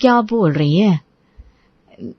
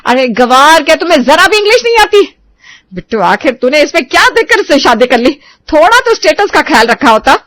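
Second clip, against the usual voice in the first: angry scolding; 5 semitones higher, 4 dB louder than usual. Acted. Mother-in-law berates ignorant bride.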